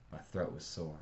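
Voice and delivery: ordinary delivery, nothing marked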